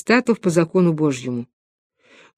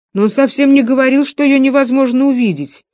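higher-pitched protesting argument; defensive; 5 semitones higher than usual. A narrator's reading. Пётр defends his idea of visible souls, defensive. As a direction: insist against the objection. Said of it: "защищался Пётр".

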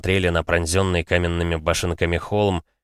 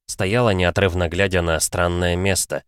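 ordinary delivery, nothing marked

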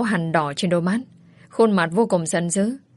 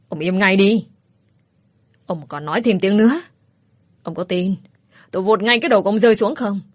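grit the teeth and threaten him; through gritted teeth; faster than usual. Acted. Daughter threatens her father with a sickle, furious. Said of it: "nghiến răng nói".

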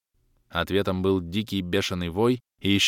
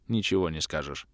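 ordinary delivery

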